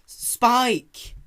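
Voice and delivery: Rarity calling to Spike at the door. Acted. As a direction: call after him as he leaves. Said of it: "Rarity called out".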